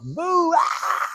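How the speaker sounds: very high whooping cry; celebration whoop. Retold or imitated. Joe celebrates the win, high whooping cheer. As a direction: cheer wildly.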